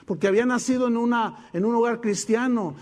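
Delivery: normal talking voice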